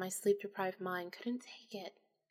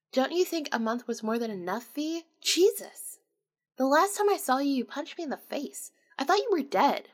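louder, higher, shocked and upset; exasperated scolding; 4 semitones higher, 6 dB louder than usual. Acted. Jeff scolding Vanessa for vanishing a month, loud and exasperated. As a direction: let the worry burst out at her.

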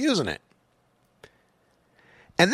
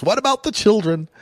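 higher-pitched mock outcry; mock alarmed plea; 6 semitones higher than usual. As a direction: parody a worried moralist's plea. Mocking prohibitionists' stock plea.

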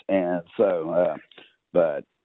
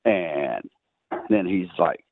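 ordinary delivery

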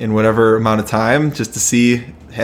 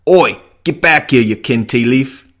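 put-on Cockney accent; 2 semitones higher, 4 dB louder than usual. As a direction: call after a thief in mock Cockney. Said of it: "sorry for that, that accent there".

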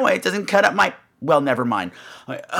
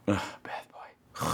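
breathy swoon; 11 semitones lower, 15 dB quieter, much slower than usual. Real, not acted. Dreaming about the performer, a slow smitten whisper.

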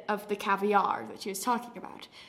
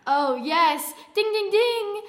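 high, loud winning bell; pitched-up excited ruling; game show host; 9 semitones higher, 5 dB louder, slower than usual. Pretend. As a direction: celebrate the answer with sung dings. Game show host confirms the answer.

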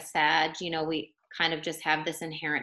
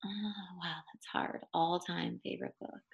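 muttering to self; 11 dB quieter than usual. Real, not acted. Thinking aloud under breath.

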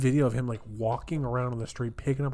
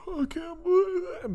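mock crying. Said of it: "most people are, like, crying".

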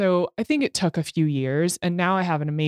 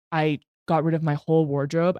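normal talking voice

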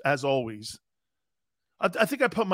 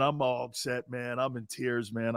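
normal talking voice

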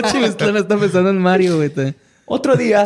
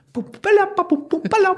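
drum imitation. Imitating the conga player.

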